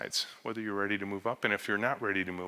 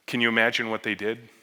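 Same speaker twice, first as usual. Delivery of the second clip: usual speaking voice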